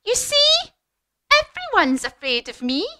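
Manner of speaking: high, loud triumphant squeak; boasting little mouse. Acted. Mouse showing off to the Gruffalo, triumphant boast. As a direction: crow it proudly, starting very high. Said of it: "the mouse turned round to the Gruffalo and said".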